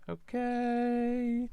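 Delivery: very high, loud, drawn-out singsong; mock-bemused reaction. Crafter voicing what viewers are thinking, high singsong mock bemusement. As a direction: stretch it out high like a wary onlooker.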